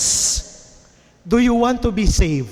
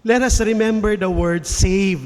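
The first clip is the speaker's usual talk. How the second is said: ordinary talk, nothing marked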